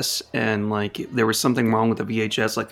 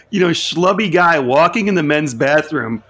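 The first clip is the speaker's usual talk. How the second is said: usual speaking voice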